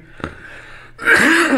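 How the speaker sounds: harsh throat-clearing cough. Real, not acted. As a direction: cough hard into the mic. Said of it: "This is more than a cough".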